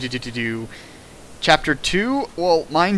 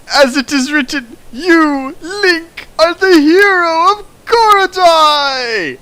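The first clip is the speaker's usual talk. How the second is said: rising, louder, higher grand prophecy cry; epic proclamation; 14 semitones higher, 7 dB louder, slower than usual. Acted. Riffer hams up a prophecy to Link, grand and loud. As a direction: proclaim it like a hammy oracle.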